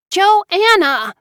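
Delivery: high, loud angry shout of her name; furious call. A narrator's reading. Loud furious shout. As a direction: Mara Joy yelling through the door.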